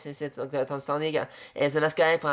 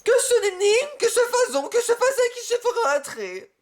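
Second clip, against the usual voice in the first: high put-on chant voice; high mock-mystic voice; mock incantation. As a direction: intone nonsense like a spell.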